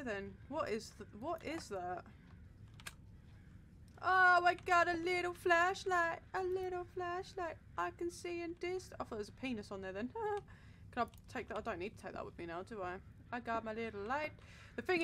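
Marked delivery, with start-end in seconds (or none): 4.0-8.9 slow, higher delighted find. Real, not acted. Player finds a flashlight, slowly delighted. 10.1-10.9 a short high giggle; laugh. Real, not acted. Player giggles at his own silly thought, laughing.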